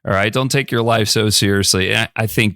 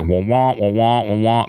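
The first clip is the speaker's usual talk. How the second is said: droning wah-wah, lightly done; mock muffled teacher drone; Peanuts teacher. Teen tunes out an anti-drug lecture with a mild wah-wah drone. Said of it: "like the Peanuts teacher".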